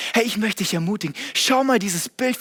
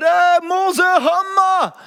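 much higher, louder cheer; cheering crowd; 8 semitones higher, 6 dB louder, much slower than usual. Retold or imitated. The people cheer Moses after Egypt, loud excited cheer. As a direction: hype him up like a fan.

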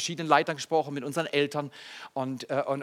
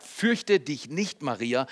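slow, softer and lower angel voice; gentle reassurance; 4 semitones lower, 5 dB quieter, slower than usual. Acted. Angel calming a startled Mary, gentle slow reassurance. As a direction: soothe her slowly and quietly.

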